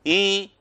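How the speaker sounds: high ई held through the nose; nasal vowel. Shown as a demonstration. Teacher isolates the nasal sound, ई through the nose. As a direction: nasalise ई, mouth and nose together. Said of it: "नाक से बोलते हैं".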